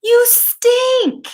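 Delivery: high, loud disgusted cry; family crying out. Acted. Family shouting at smelly Bill, high disgusted cry. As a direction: shriek it like a family fed up with the smell. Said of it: "His family would cry".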